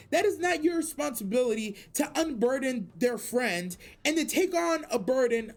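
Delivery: ordinary talk, nothing marked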